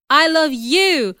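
slow, high, loud avowal; impassioned and drawn out; passionate declaration. A narrator's reading. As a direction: declare love with full emphasis.

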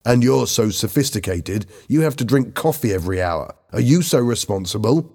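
ordinary delivery

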